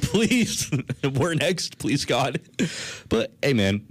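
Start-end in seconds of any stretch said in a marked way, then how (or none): 0.0-2.4 high pleading prayer with a laugh; mock prayer. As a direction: beg God half-jokingly. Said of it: "like, a prayer to God".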